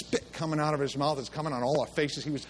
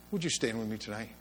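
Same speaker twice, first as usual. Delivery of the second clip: low, soft invitation over music; altar call; 5 semitones lower, 8 dB quieter than usual. Acted. Preacher quietly inviting the congregation to stand, soft. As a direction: invite them forward gently.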